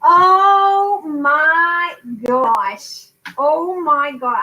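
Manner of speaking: long drawn-out, high exclamation; amazed. Real, not acted. Hearing a viewer kept her old kits, amazed. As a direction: stretch every word in slow amazement.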